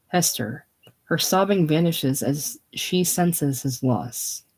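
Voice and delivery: normal talking voice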